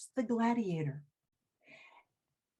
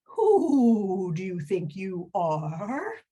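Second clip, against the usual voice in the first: slow, drawn-out owl hoot; 2 semitones lower, 4 dB louder, slower than usual. Acted. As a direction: hoot the question out. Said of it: "They always talk like that".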